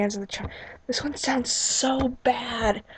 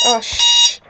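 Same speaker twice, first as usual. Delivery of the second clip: hushing; 8 dB louder than usual. Real, not acted. Hushing a noise.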